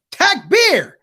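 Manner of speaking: very high, eager shout; imitated yell. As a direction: act out jumping up to yell it. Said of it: "I'm just gonna yell Takbir".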